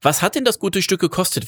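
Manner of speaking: put-on high-pitched, curious boy; boy's voice. A narrator's reading. Boy suddenly beside the driver asking the price, high and curious.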